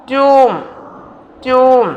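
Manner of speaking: "tomb" as tyoom, silent b; long oo and final m in "tomb"; careful model "tomb", falling; correct pronunciation. Shown as a demonstration. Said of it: "correct pronunciation is".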